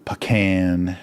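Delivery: Pecan said slowly as puh-KAN; emphasized puh. Shown as a demonstration. Showing viewers the Texas way, Pecan as puh-KAN. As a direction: start Pecan on puh, never pee. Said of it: "I'm emphasizing the P over the P".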